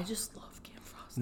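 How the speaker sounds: guilty secret whisper. Real, not acted. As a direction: confess it under your breath. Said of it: "My mom's gonna slap me".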